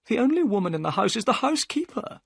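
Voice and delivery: fast, high, worried; Thaddeus Sholto. A narrator's reading. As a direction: rush it out in alarm. Thaddeus hears crying.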